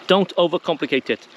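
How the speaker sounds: normal talking voice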